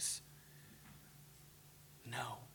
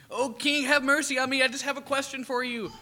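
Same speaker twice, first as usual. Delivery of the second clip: begging; 9 semitones higher, 5 dB louder than usual. Retold or imitated. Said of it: "scraping and crawling and begging".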